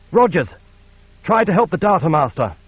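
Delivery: loud, firm order; commanding. Acted. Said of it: "she commanded".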